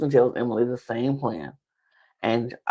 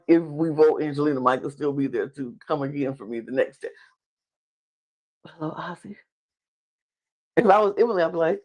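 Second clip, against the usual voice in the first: usual speaking voice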